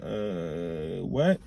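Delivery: blank, frozen in the moment; stunned. Retold or imitated. Reacting to the accusation in the meeting, dazed and quiet. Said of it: "everything around you goes silent".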